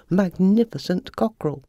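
plain reading voice

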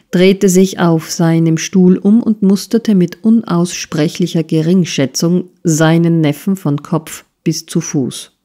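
ordinary delivery, nothing marked

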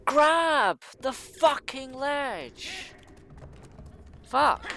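high, loud, very drawn-out shout; pleading shout at the game; desperate yell. Real, not acted. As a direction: stretch every word in frantic rage. Desperate yelling during jump.

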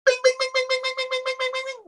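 very high far-off alarm beeping; alarm bing. Acted. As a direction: imitate a museum alarm going off. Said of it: "paintings could have alarms".

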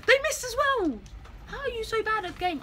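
mock the other team's miss; excited taunt. Real, not acted.

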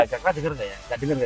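quiet. Pretend. Pretending others can't hear.

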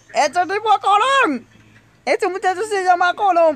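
high, loud cry of disbelief; shocked exclamation. Real, not acted. Player realising who the dead character is, shocked exclamation. Startled by message about drowned character.

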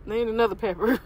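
eating between words; talking while chewing. Real, not acted. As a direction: mouth partly full. Seen 2: Hand brings pepper to mouth at 1.3; mouth closed and working afterward.

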